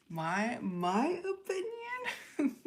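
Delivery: high, drawn-out, incredulous question breaking into laughter; taken aback, laugh through the question; surprised, amused. Real, not acted.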